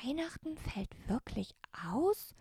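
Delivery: soft whispered question, rising on aus; murmured to herself. Acted. Jasmin murmurs to herself alone, whispered question. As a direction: mutter it under your breath in disbelief. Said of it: "murmelte Jasmin vor sich hin".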